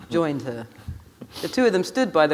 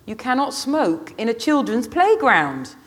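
slow, high, raised voice; old lady shouting; 7 semitones higher, 5 dB louder, slower than usual. A narrator's reading. Old lady shouting from the bench at the smokers, indignant. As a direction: call it out loudly, word by word. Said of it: "shouted the old lady from the bench".

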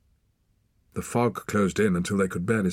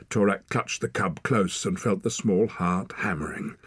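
usual speaking voice

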